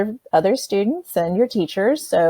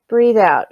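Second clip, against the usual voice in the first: louder, falling cue to breathe out; guided exhale cue; 2 semitones higher, 5 dB louder than usual. Shown as a demonstration. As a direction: push the out-breath with a firm falling voice. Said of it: "you're gonna breathe out".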